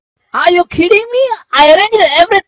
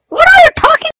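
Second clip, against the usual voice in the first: shocked; 13 semitones higher, 6 dB louder, faster than usual. Acted. Wife shocked by accusation.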